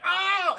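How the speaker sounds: loud, high exclamation of wonder; awed "oh". Retold or imitated. Streamer reacting to the park reveal, loud awe. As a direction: let out a big mock gasp of "oh".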